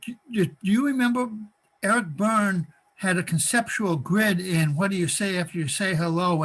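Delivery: ordinary talk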